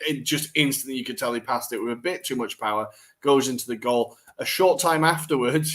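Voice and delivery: ordinary delivery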